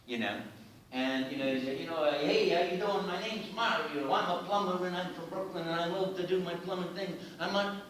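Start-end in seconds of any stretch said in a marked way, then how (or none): 2.2-7.8 higher, lightly voiced Brooklyn plumber; tentative actor's Mario. Imitating another actor auditioning, higher plumber voice. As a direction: recite a stock Brooklyn plumber introduction. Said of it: "every other actor would start".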